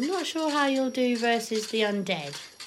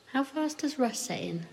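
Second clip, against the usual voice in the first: usual speaking voice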